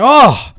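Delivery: one high, loud "oh"; hammed-up ecstatic cry; mock climax cry. Customer finishing with the madam, mock ecstatic cry.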